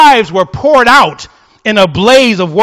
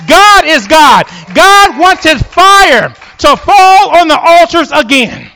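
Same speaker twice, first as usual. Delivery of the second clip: crying out; 6 semitones higher, 3 dB louder, slower than usual. Retold or imitated. People fall on faces crying.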